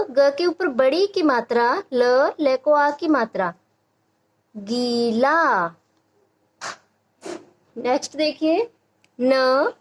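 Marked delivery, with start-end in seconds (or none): 4.5-5.7 गीला very slowly, ee and aa held; long vowels. Shown as a demonstration. Teacher sounding out a spelled word for children, long vowels. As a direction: stretch both syllables of गीला. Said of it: "इसका उच्चारण थोड़ा लंबा".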